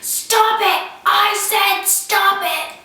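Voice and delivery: put-on high, loud, drawn-out kid yell; child's yell. Three-year-old yelling at his toy-snatching brother, imitated loud yell. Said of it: "he would just yell".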